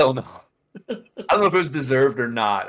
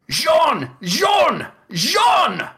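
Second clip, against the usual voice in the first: high, loud shouted calling; retold shout of a name, much higher; 4 dB louder, much slower than usual. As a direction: call across the site to a coworker. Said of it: "And he's, like, screaming".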